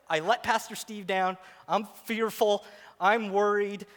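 normal talking voice

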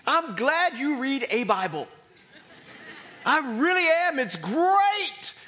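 loud, high, forceful preaching; emphatic. Real, not acted. Preacher assuring congregation any Bible version is fine, emphatic. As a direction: punch it out loud and high, leaning on each word. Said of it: "I'm emphasizing that".

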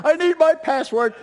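high, loud frantic plea; panicked staffer. Retold or imitated. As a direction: squeal like a panicked coworker. Staff with hair on fire.

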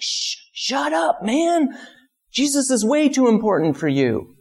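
high, put-on scolding voice; mocking crowd voice. Bystanders scolding the beggar to shut up, high mock voice. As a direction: sneer at him in a high caricature.

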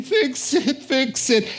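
high, pleading "fix it"; desperate plea. Retold or imitated. Desperate plea voiced by congregant.

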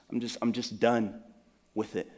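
a listener walking away from anger, defeated; resigned and done. Acted. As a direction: let it sag into weary resignation. Quoting a person giving up.

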